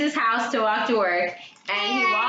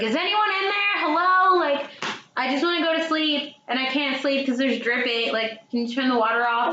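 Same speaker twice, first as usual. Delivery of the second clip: calling through door; 7 semitones higher than usual. Retold or imitated. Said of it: "She's like".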